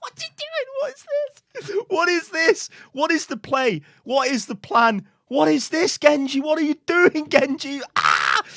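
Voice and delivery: high, squealed disbelief building to a loud yell; exasperated outburst. Real, not acted. Gamer to a feeding Genji, exasperated outburst. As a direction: cry out in real exasperation, ending on a yell.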